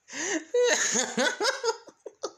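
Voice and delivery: high-pitched, maniacal cackle; shrill mocking cackle; villain laugh.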